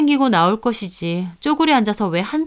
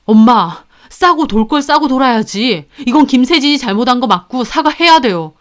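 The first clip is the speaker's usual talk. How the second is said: firm confrontation; 3 semitones higher, 5 dB louder than usual. Acted. Husband confronting his mother.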